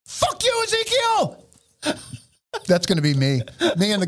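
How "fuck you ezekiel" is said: mocking high voice. Joking quote of a fantasy retirement.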